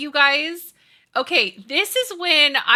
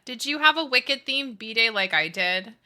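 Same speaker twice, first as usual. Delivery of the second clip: normal talking voice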